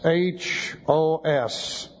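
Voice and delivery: "H O S" spelled letter by letter, very slow; spelling out letters. Shown as a demonstration. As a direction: dictate "H O S" letter by letter. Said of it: "relative pronoun hos".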